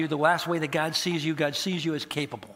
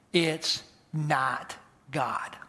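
ordinary delivery

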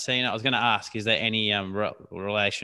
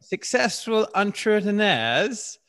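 slow, high-pitched mock northern English accent; attempted northern English accent; 9 semitones higher, slower than usual. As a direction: have a go at northern English, stretching every vowel. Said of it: "In a no-, in northern accent".

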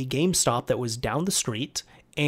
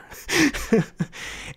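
laugh; 11 semitones higher than usual. Real, not acted. Speaker laughs at the scene.

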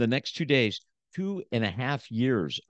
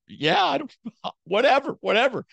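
high, loud shrugging whatever with a laugh; loud, mocking don't-care voice; dismissive whatever; 13 semitones higher, 5 dB louder than usual. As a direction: brush her off, half laughing. Imitating his own dismissive self.

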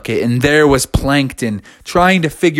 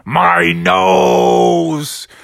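drawn-out shark villain cry, pitched up; wounded villain Jaws; 4 semitones higher, slower than usual. Acted. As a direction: howl a cartoon villain's parting threat. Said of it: "And he was saying".

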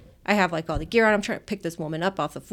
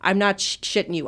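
ordinary talk

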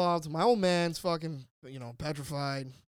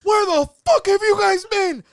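furious shout; 17 dB louder than usual. Retold or imitated. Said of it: "opens the fucking door".

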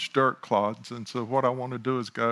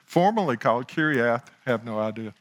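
ordinary talk, nothing marked

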